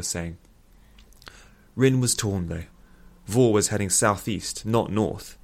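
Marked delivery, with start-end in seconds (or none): none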